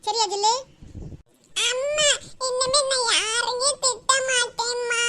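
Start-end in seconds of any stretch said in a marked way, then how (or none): 1.7-5.0 slow, drawn-out tearful promises; sing-song whine to mother; crying child. Acted. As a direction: plead like a sobbing little girl. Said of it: "அழுது அழுது மூஞ்சி பூரா".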